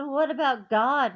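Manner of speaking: retold indignant outburst. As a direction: demand an answer. Indignant protest to operator.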